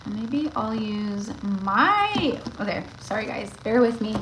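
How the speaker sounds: ordinary delivery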